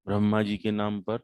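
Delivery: ordinary delivery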